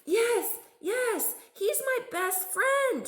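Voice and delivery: joyful shout. A narrator's reading. Girl Lisa finds her lost bear, joyful high shout. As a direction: shout it out, thrilled. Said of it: "shouted Lisa".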